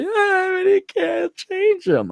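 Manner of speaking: mock girl voice, very high and drawn out; exaggerated high whine, imitating a besotted girl; girl in denial. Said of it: "Some of you girls are just like".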